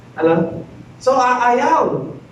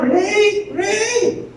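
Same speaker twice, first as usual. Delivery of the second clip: high-pitched mock cry for help; shrill call for the servants, half-acted; 11 semitones higher than usual. Wife calls the servants against Joseph, high mock cry.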